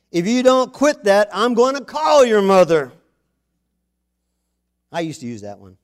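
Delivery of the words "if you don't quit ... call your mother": mock dad threat. Father threatening to call the mother, loud mock threat. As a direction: warn the kid like a fed-up father. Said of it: "to say the darndest things".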